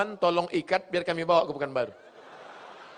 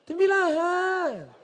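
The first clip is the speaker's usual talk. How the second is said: high slow drawn-out answer; drawn-out reply; 13 semitones higher, slower than usual. Retold or imitated. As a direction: stretch it out high and coy. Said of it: "phone prank voice".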